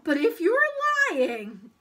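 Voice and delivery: put-on loud, high boyish threat; emphatic, suspicious child; warning. A narrator's reading. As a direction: warn the tempter forcefully. Paul gives in, warns.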